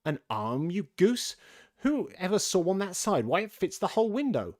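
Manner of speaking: high, loud indignant rabbit; exasperated scolding. A narrator's reading. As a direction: scold the servant in disbelief.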